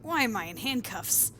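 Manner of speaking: loud, startled question; alarmed. Acted. Prisoner finding himself cuffed, acted alarm. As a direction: blurt it out in alarm. Character finds himself handcuffed.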